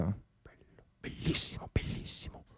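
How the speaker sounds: hushed repeated whisper; soft breathy mock delight. Real, not acted. Reaction to love telegram.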